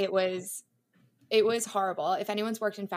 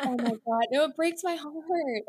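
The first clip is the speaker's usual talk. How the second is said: sympathetic; 8 semitones higher, 4 dB louder than usual. Real, not acted. Hearing about girl crying at work.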